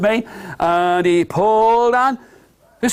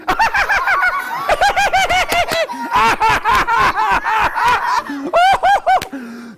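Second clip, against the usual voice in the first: big princess laugh. Acted. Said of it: "Can you do that with me?".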